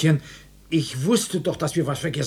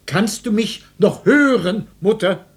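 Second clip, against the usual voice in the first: loud, higher check for a response; calling out; 5 semitones higher, 5 dB louder than usual. Acted. Husband checking whether collapsed mother still hears, calling out. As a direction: call to someone who may not hear.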